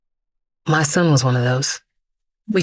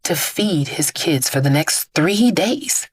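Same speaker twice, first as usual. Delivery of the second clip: ordinary delivery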